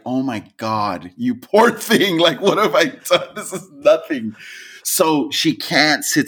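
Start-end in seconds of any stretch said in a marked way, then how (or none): 0.0-4.3 laughing mock pity. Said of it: "I'm like".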